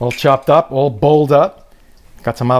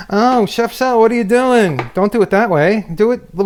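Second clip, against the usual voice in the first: high, whiny complaining viewer; 7 semitones higher than usual. Retold or imitated. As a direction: whine it like a fussy fan. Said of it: "I can hear you guys complain".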